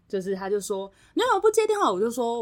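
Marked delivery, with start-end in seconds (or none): 1.1-1.9 retold yell. Boss yelling down the phone about missed calls, mock yell. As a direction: bark it out like an angry boss. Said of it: "大吼".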